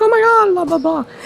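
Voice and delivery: mock excited reunion chatter; excited gushing. Wife recognizing the teacher, excited high squeal. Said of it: "there's like".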